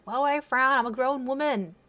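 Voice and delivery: ordinary talk, nothing marked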